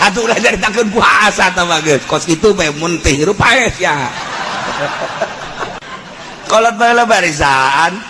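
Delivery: ordinary talk, nothing marked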